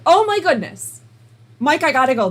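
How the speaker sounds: high and loud, hurried; retold excited hurry to hang up; excited rush. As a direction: burst out to the husband in excitement. Rushing off phone, excited.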